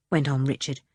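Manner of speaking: plain reading voice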